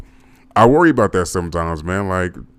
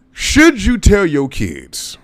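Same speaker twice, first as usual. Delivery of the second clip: slow, drawn-out mock-serious question, pitched up and louder; mock dramatic question; 11 semitones higher, 6 dB louder, much slower than usual. Vlogger to his viewers, a slow mock-solemn question. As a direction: ham up the big question like a talk-show host.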